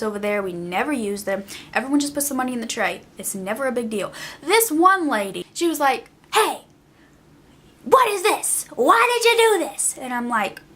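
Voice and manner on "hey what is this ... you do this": high, indignant mock customer voice, pitched well up; rude customer. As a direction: play the outraged drive-thru lady, high and huffy.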